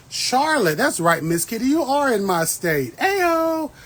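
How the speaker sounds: loud, high-pitched hollering hello; big whooping shout-out; excited shout-out. Real, not acted. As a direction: call out to a fellow local, thrilled. Greeting a viewer from her state.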